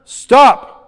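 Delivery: loud, high, sharp order; shouted command. Acted. Preacher mimicking an order to the waves, commanding.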